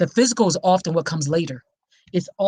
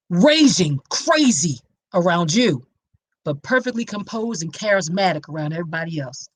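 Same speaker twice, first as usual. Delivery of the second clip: ordinary talk, nothing marked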